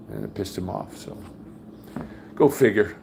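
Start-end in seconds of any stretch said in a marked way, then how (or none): none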